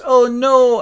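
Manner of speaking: high, loud mock dismay; distressed lover. Lover left in trouble at court, mock distress. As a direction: cry out like a melodramatic heroine. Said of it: "And she goes".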